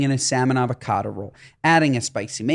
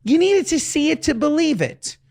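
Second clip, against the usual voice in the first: hyped up; 11 semitones higher than usual. Acted. Hyping the episode's bits.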